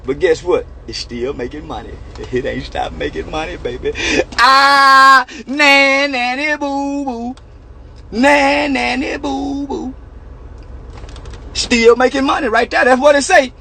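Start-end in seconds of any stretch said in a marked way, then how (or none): 4.4-7.4 drawn-out nanny boo boo, slow; slow playground taunt; slow singsong taunt. Real, not acted. Repeated childish taunt. 8.1-9.9 slow singsong taunt. Real, not acted. Taunt repeated.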